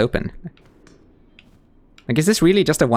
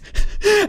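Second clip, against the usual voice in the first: Sherma's high giggle. Acted. Sherma laughs.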